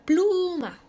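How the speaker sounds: "pluma" with a long, stressed PLU. Shown as a demonstration. Teacher giving an example of prosodic accent, stress on PLU. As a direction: stretch and punch the PLU of "pluma". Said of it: "ahí está lo más fuerte".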